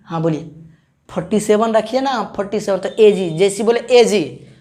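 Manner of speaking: ordinary talk, nothing marked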